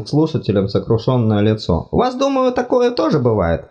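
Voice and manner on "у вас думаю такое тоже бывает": high fussy god voice, apologetic; flustered deity, much higher pitched; embarrassed god. Acted. God speaks.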